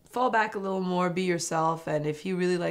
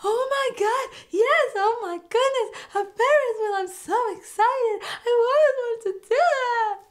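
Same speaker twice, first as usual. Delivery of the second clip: much higher, louder, gushing with delight; excited squeal; 6 dB louder than usual. Retold or imitated. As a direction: squeal like you've always dreamed of it. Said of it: "I loved her enthusiasm".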